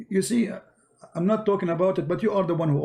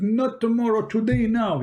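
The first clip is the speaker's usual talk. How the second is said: higher, insistent imitation of the sheikh; excited sheikh; 7 semitones higher than usual. Debating sheikh demands the proof right away, high and insistent. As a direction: push him hard to do it today. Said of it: "He get more excited".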